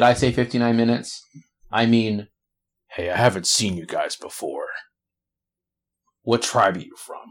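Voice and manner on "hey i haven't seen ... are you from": orc voice. Acted. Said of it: "This is barbarian orc that you were talking to".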